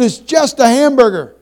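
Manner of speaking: high, loud scolding father; retold berating. Father scolding his son at the restaurant. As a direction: snap at the boy, exasperated. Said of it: "I berated him".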